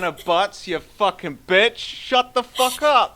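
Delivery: hammed-up angry shout, slowing on the insults; mock furious outburst. Host blowing up at Hannah Butts, mock furious shouting.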